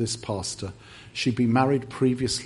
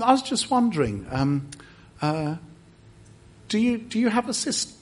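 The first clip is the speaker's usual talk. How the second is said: gentle high tentative; 11 semitones higher than usual. Shown as a demonstration. Advising a stranger at church, asking in a high hesitant voice. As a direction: ask shyly like a kind stranger. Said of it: "You can just say something like".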